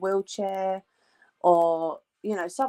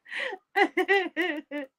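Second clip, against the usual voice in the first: high crying laugh; 10 semitones higher than usual. Real, not acted.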